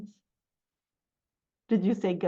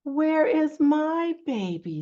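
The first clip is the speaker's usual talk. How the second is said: high, slow mother cow; cow character; 6 semitones higher, 4 dB louder, slower than usual. Acted. Storyteller voicing a cow seeking her baby, slow call. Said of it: "says the cow".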